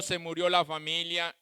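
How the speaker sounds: normal talking voice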